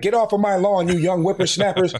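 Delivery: hammed-up crotchety geezer; grumpy old man. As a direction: play the cranky old man chasing kids off. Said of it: "I'm at the age now where".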